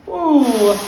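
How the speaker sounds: delighted ooh. Acted. Sudden reminder of Transylvania home.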